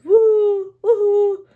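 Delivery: high, loud wailing siren woo; sing-song police siren howl; imitating a siren. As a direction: wail the siren like a child. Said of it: "says Peppa".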